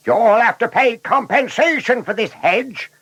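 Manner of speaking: high-pitched angry roar; roaring shepherd. A narrator's reading. Shepherd demanding payment for his hedge, roaring. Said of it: "roared the shepherd".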